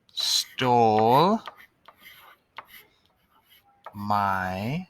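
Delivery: each word said alone, with long pauses between them; slow dictation. Shown as a demonstration.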